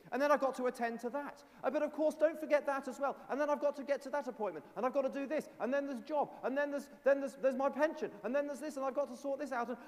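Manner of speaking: high, fast and loud, running through a to-do list; breathless panic over everything to do; frantic busy list. Acted. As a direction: rattle off chores like you're overwhelmed. Frazzled believer listing busy chores.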